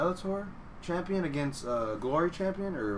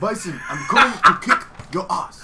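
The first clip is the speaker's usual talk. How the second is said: put-on Dutch accent; 3 semitones higher, 8 dB louder than usual. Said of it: "that Dutch accent".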